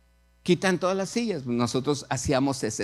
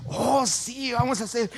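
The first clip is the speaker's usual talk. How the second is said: high, gushing acted yes; delighted agreement; 7 semitones higher than usual. Girl agreeing to start a church, delighted. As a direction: jump at the offer.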